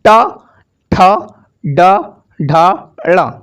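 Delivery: ट, ठ, ड, ढ, ळ each spoken alone with pauses; slow recital of the letters. Shown as a demonstration. Teacher urges students to try ट, ठ, ड, ढ, ळ, sounding each out. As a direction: sound out ट, ठ, ड, ढ, ळ to feel the tongue curl. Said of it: "बोल कर तो देखो".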